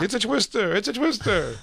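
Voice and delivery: high-pitched cry of alarm, imitated; panicking airplane character. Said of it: "He's like, remember an airplane".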